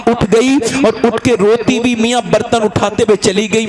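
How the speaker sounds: ordinary delivery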